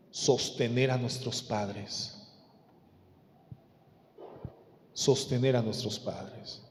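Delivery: low, soft, measured pulpit voice; hushed solemn preaching. Real, not acted. Preacher admonishes children about supporting parents, hushed solemn preaching. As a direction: bring it right down, gravely.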